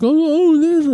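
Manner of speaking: put-on high, quavery old man voice. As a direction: squeak it out like a doddering old man. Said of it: "It's like this old guy".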